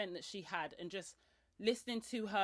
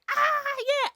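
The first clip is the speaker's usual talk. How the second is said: excited ah yeah; 12 dB louder than usual. Retold or imitated. Host reacting to workshop offer.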